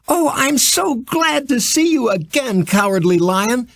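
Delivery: put-on high, bright girl's voice calling out gladly; a young girl greeting an old friend with joy; glad little girl's cry. A narrator's reading. Little Kansas girl greets the Cowardly Lion, high and glad. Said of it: "cried the little Kansas girl".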